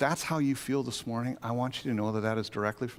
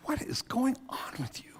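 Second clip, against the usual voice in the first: stern whisper; 11 semitones higher, 5 dB quieter than usual. Retold or imitated. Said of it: "He goes".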